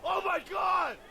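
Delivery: high, slow stunned yell; shocked shout. Real, not acted. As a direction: let the shock burst out. Stunned by stream event.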